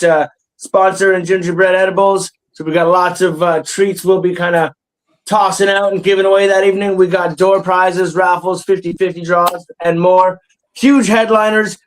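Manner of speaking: usual speaking voice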